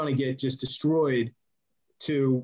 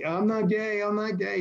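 higher whiny imitated Chad; whiny denial; 7 semitones higher than usual.

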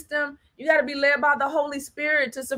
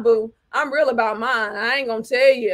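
ordinary talk, nothing marked

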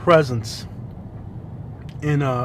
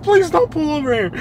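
high-pitched mock pleading; hammed-up high panicky plea; imitating panicked plea; 11 semitones higher than usual. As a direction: beg like a freaked-out passenger. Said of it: "freaking out".

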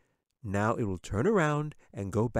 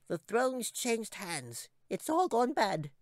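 high, slow, soft squeak of doom; tiny creature mourning the land; gloomy squeaky chipmunk; 13 semitones higher, 4 dB quieter, slower than usual. A narrator's reading. Chipmunk laments.

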